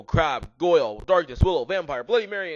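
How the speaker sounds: ordinary delivery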